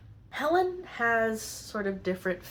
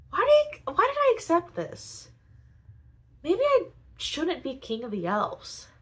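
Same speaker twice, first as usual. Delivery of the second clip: very high, louder whining regret; whiny regret; 11 semitones higher, 4 dB louder than usual. Retold or imitated. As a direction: whine like a man sorry he took the crown. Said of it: "Shadrach is like".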